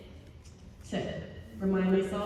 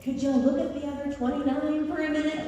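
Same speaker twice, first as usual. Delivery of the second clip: higher-pitched plaintive plea; mock exasperated decorators; 7 semitones higher than usual. Decorators begging guests to notice their work, mock exasperation. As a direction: plead as the overlooked decorators. Imagined miffed decorators speak.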